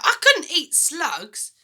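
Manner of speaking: put-on high, loud, slow boy's protest; a boy recoiling in disgust; cry out. George refusing to eat slugs, loud high cry. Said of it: "George cried out".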